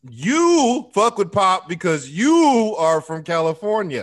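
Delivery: loud, slow, emphatic repeat; loud opinionated ribbing. Real, not acted. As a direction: hammer the point home slowly. Repeating the jab louder, slower.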